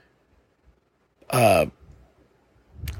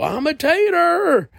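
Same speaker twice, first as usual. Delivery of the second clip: mocking; slower than usual. Mocking proud posters.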